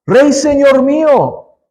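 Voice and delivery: desperate cry. Acted. Woman on the wall begging the king of Israel, desperate cry. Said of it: "una mujer le gritó".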